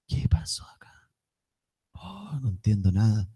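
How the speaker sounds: soft bewildered whisper; dazed whisper. Retold or imitated. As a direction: murmur it half awake, lost. Dazed after the revival.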